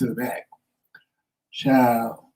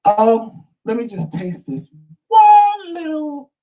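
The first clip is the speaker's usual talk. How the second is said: high singsong greedy voice, stretching the last words; greedy self; 12 semitones higher, 4 dB louder, slower than usual. Retold or imitated. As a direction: play your own greedy self, pitched way up. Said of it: "My greedy self goes".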